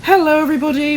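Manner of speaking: high, loud cheery greeting; bright-eyed, high-pitched hello; peeped. A narrator's reading. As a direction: pipe up like a little engine. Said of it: "peeped Percy".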